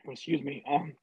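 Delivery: hoarse after swallowing wrong way; throat still catching from water gone wrong way; swallowed wrong way. Real, not acted. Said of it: "glass of water went down the wrong way".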